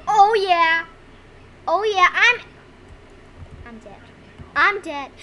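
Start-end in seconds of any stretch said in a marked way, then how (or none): 0.0-2.4 loud triumphant cheer; excited boasting. Real, not acted. Boasting about their kills. 3.6-4.0 very soft, low, sudden letdown; deflated. Real, not acted. First take, just got killed.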